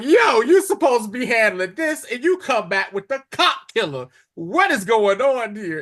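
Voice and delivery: acted-out heated confrontation; retold indignant scolding. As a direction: demand an explanation, exasperated and animated.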